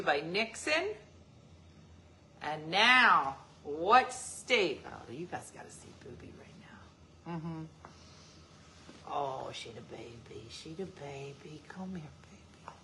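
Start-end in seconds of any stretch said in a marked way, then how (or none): 4.9-12.7 cooing pet names. Real, not acted. Talking to the baby dog.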